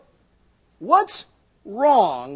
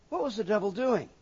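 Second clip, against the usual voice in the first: ordinary talk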